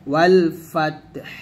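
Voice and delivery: slow recited ওয়াল ফাতহ with a clear breathy হ at the end; Quran recitation. Shown as a demonstration. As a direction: intone ওয়াল ফাতহ slowly and voice the final হ. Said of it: "হায়ের উচ্চারণটা নিয়ে আসব". (ধীরে তেলাওয়াতের সুরে ওয়াল ফাতহ, শেষের হ স্পষ্ট)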